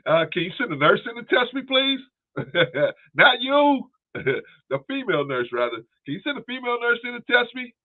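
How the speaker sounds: mock patient plea. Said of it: "pretend patient asking Peterson".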